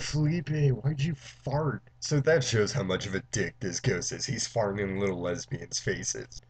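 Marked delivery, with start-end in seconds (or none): 0.0-1.8 higher, drawn-out mock whine; complaining nerdy girl. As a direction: imitate a put-out girl, slowly. Nerdy girl complains to Felipe, acted.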